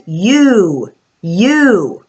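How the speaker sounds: "You" as a long clear yoo sound. Shown as a demonstration. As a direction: say "You" like the letter name, twice. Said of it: "But U also says".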